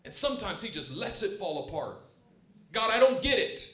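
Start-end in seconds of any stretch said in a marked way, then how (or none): 2.7-3.7 higher, louder indignant questioning; frustrated complaint. Retold or imitated. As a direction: demand answers from God. Voicing complaint to God.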